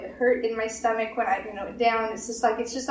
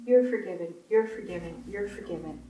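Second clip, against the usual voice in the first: gentle fading reassurance; 4 semitones lower, 4 dB quieter than usual. Acted.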